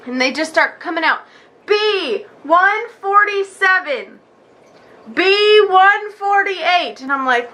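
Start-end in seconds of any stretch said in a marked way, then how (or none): 1.7-7.0 slow, drawn-out clerk call, higher; number caller. Retold or imitated. Clerk calling numbers to the waiting room, slow and higher. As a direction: stretch every syllable like a waiting-room announcer.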